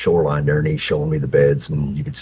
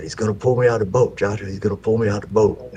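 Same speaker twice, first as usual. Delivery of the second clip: fast, higher put-on scared little boy; mock panicked child; 6 semitones higher, faster than usual. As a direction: replay the kid's panic for laughs. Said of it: "I knew my lines".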